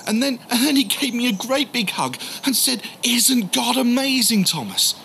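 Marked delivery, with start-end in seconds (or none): 3.0-4.9 high, slow, beaming with joy; joyful exclamation. Retold or imitated. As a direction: gush it out in delight. Peter hugs him joyfully.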